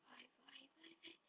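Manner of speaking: hushed urgent whisper. Acted. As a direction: whisper it, urging it on. Urging quietly in the dark.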